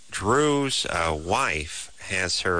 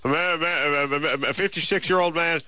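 rushed screener; 7 semitones higher than usual. Retold or imitated. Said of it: "They're just like".

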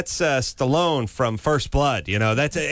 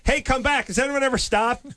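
calling after someone; 11 semitones higher than usual. Acted. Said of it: "I just like the guy".

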